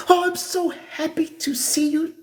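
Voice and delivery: high sugary welcoming voice; fake church greeter. Church greeter faking welcome to a visitor, high sugary voice. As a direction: gush over the visitor falsely. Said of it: "Same folks be gossiping about you".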